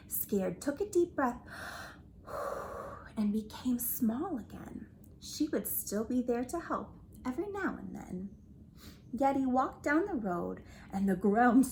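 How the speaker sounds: ordinary delivery, nothing marked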